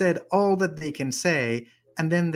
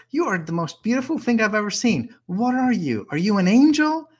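awed questioning; 6 semitones higher than usual. Acted. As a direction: marvel at love, rising to a near cry. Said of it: "Rumi starts talking to love".

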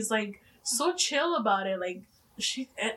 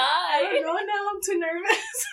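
higher, slow, nervous giggly voice; mock nervous; 8 semitones higher, 3 dB louder, slower than usual. Friend quoted as too nervous to read, high and giggly. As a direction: act shy and nervous, laughing. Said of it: "Elizabeth was like".

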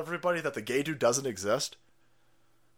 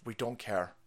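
usual speaking voice

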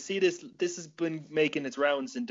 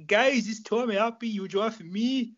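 higher pitched put-on fighter voice; playing the fighter in an instructional video; hammed-up humble champion talking to camera; Tom Halpin impression; 7 semitones higher than usual. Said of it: "You did it perfectly".

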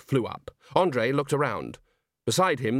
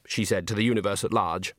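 usual speaking voice

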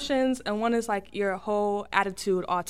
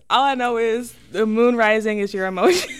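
giggling through the words; laughing embarrassed; laughing while talking; 5 dB louder than usual. Real, not acted. Guest laughs while admitting she doesn't know.

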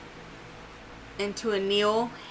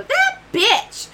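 much higher, loud squeaky yell; shrill creature cry; 7 dB louder than usual. Acted. Little Elsie echoing the insult, high squeaky yell. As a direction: screech it like a tiny creature.

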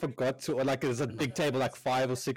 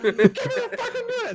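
loud, very high mock yell; hammed-up kitchen fury; raging cook; 7 dB louder than usual. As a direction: imitate a cook's screeching demand. Imitating cook's outburst.

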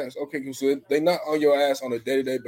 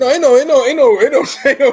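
high, loud repeated "ain't no"; a loud high chant of "ain't no"; loud denial; 12 semitones higher, 14 dB louder than usual. Real, not acted. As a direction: shout the denial, almost laughing.

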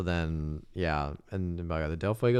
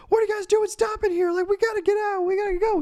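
high, loud hammed-up panic; panicked high yelp; frantic mock panic; 5 dB louder, faster than usual. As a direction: squeal it like a frightened biker. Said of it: "It's like".

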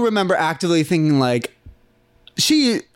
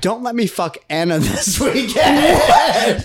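laughing; 7 semitones higher, much slower than usual. Real, not acted. Retelling a request while cracking up.

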